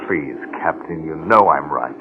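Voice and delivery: low, soft appeal; quiet plea. Acted. Oates begs the Captain to agree, quiet plea. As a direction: plead with the voice dropped low.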